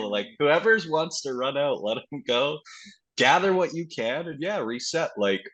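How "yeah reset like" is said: ordinary delivery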